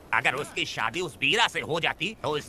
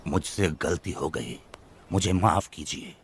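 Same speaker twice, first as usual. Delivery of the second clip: forced apology; 9 semitones lower than usual. Acted. Forced to apologise under pressure.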